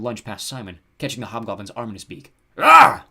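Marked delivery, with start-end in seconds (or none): 2.6-3.1 loud, high goblin scream; sudden scream of pain; very high yelp-like scream. A narrator's reading. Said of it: "Hogsquill screamed".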